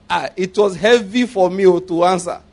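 normal talking voice

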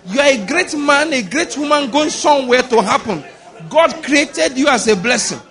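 loud, high, driven declarations; full-force preaching. Real, not acted. Preacher rousing the congregation to speak to their souls, full-force preaching. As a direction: preach it hard, hammering each phrase.

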